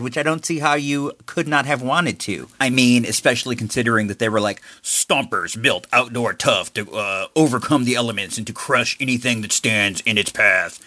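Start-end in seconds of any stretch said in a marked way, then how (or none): 4.8-10.8 commercial announcer voice. Pretend. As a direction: read it like a TV toy ad. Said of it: "forgive that voice".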